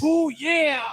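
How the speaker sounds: a high, growled Macho Man Randy Savage "Oh yeah". Retold or imitated. Retelling the Slim Jim ads in the Macho Man voice. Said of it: "the Macho Man going".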